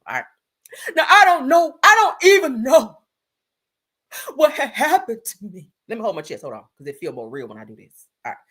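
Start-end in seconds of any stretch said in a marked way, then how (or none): 1.0-5.7 loud, high fake-crying wail. Redoing a singer's last line in full character, hammed-up crying. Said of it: "I don't feel like I was in full character".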